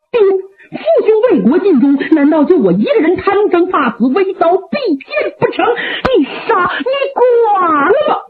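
越说越高, 最后放慢哭喊"你剐了吧"; 儿子伸脖求死, 悲愤哭喊; 哭着求死. Acted. 八郎求死哭诉. (悲愤哭喊)

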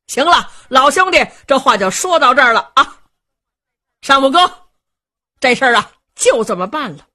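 loud, high, hearty assent; crowd agreeing. Acted. Heroes in chorus accepting the apology, hearty loud agreement. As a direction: settle it cheerfully together. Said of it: "众位英雄异口同音".

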